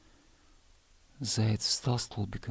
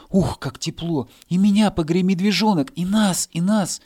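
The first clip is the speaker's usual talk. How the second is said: higher, louder joyful pleading; excited calls; 8 semitones higher, 8 dB louder than usual. Acted. Grass blades begging the bear cub, excited.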